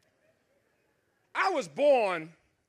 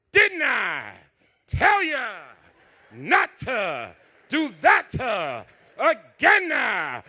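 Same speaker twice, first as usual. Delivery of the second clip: loud, slow church-preacher mom; preaching mother; 7 dB louder, much slower than usual. Retold or imitated. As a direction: preach each word like a lick. Mother preaching while whipping.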